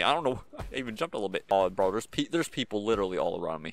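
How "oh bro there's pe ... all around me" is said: ordinary talk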